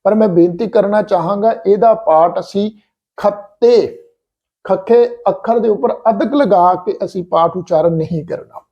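ordinary delivery

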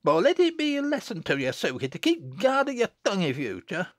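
put-on high, quick scolding child; haughty warning. A narrator's reading. Jennet warning Susan about her tongue, haughtily. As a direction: lay down the law, starting very high. Child lectures the weeping girl.

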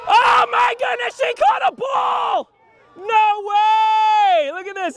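high, loud cheering yell; excited shout. Real, not acted. Boy shouting as a teammate makes a surprise catch.

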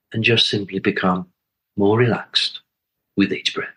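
calm, spacious meditation leading; guided meditation. Acted. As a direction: guide listeners gently inward, phrase by unhurried phrase.

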